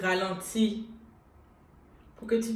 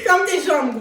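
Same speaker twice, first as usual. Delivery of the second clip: angry command; 5 semitones higher, 7 dB louder than usual. Pretend. Telling a man off directly.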